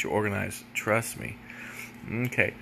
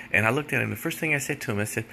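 normal talking voice

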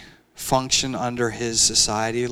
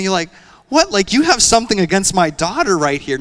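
indignant; 5 semitones higher, 6 dB louder than usual. Real, not acted. Father indignant over the insult.

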